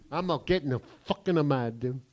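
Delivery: ordinary delivery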